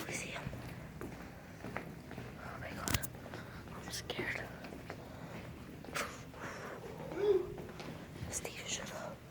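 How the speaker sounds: barely audible, halting whisper; hushed and frightened; scared whisper. Real, not acted. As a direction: whisper in hiding, long pauses. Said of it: "we're just whispering".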